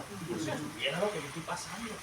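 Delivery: exaggerated private complaint in a softened voice; mock inner retort. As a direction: act out grumbling inwardly, rising at the end. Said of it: "Dentro de, porque uno es así".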